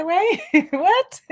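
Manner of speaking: high laughing disbelief; surprised. Retold or imitated. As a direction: caught off guard, laughing. Shocked reply to mother.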